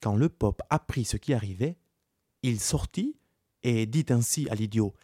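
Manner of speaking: ordinary delivery, nothing marked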